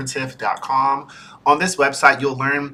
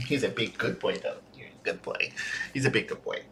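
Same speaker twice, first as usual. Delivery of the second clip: soft cooing; 2 semitones lower, 14 dB quieter than usual. Real, not acted. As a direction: murmur praise. Cooing to dog.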